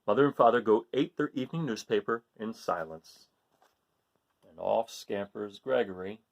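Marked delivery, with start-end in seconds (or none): none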